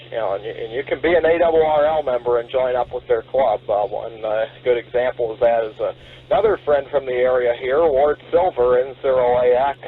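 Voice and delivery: usual speaking voice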